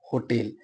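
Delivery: होटेल as "ho-TEL", clear "e" after T; stress on second syllable. Shown as a demonstration. Teacher repeating the answer, होटेल as "ho-TEL". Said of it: "क्या होता है".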